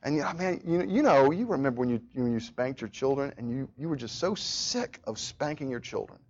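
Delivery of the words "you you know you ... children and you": ordinary talk, nothing marked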